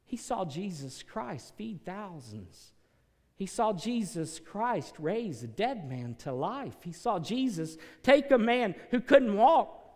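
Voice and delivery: slow, climbing to a loud high peak; building preaching. Acted. Pastor building up to a peak for his listeners, slow and rising.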